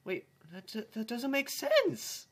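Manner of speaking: squeaky high voice cracking upward. Acted. Character's line squeaking into a voice crack. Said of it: "The voice crack".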